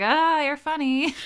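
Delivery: high, loud and slow amused reply; hammed-up amused retort; mock polite reply. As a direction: humor them with a slow forced laugh line. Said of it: "And I was like".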